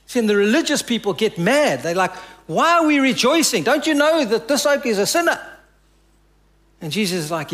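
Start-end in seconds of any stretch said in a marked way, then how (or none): 2.5-5.4 indignant Pharisee. Retold or imitated. Religious people mocked, indignant.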